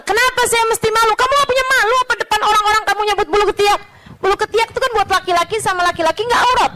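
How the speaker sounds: high, rapid-fire mock protest; indignant argument. Two sides quarrelling over shame, fast indignant retorts. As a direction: argue back in a rush.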